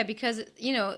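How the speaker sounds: ordinary delivery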